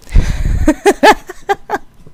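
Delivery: high loud cackle; real laughing fit; burst of laughter. Real, not acted. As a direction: crack up at the memory. Laughing at own story.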